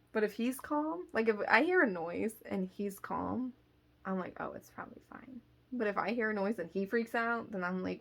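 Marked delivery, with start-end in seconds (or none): 4.4-5.4 quick, hushed self-reassurance; unconcerned reassurance. Retold or imitated. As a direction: brush it off softly, almost under the breath. Shrugs off a noise, cat calm.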